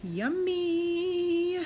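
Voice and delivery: high, drawn-out, held note; sing-song yummy. Real, not acted. Cook admiring the fried plantain, high sing-song. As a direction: sing it out high, stretching the end.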